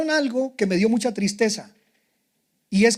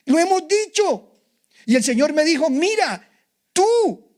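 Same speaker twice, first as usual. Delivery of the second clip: rising preaching cry; 7 semitones higher than usual. Real, not acted. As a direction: lift the voice to a fervent high pitch. Preacher builds to climax. (predicación fervorosa que sube muy aguda)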